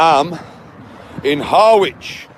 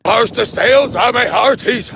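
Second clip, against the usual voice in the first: lightly voiced old sea dog; daft sailor; 7 semitones higher than usual. A hammed-up sailor for the viewers. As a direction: ham up a pirate line. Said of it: "a child might find this entertaining".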